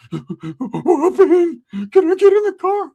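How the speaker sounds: put-on high, loud whimpering teen voice; crying cousin voice. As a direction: wail it like a scared kid cousin. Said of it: "if you fucking stop crying".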